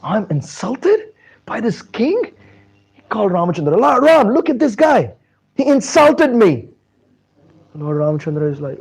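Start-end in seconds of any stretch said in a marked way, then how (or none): 0.0-2.3 high-pitched indignation; hammed-up affronted sage; acted anger. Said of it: "Vishwamitra gets angry". 3.8-6.7 high, loud indignant complaint; offended sage demanding attention; acted anger. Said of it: "Vishwamitra gets angry".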